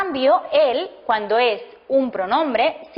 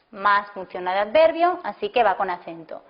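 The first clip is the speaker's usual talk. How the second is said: ordinary talk, nothing marked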